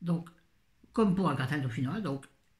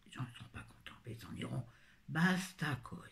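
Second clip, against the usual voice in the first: soft unvoiced aside; whisper; 8 dB quieter than usual. Real, not acted. A tired aside to herself, whispered. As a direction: breathe it under your breath, barely audible.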